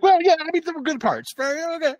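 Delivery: very high, squeaky reluctant giving in; hammed-up caving in with a strained yes; mock grudging concession. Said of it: "You'd be like".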